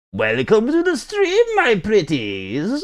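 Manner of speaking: high, drawn-out creepy welcome; spooky host. Acted. Streamer greets viewers as his pretties, in a slow spooky voice. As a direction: stretch the words out like a horror host.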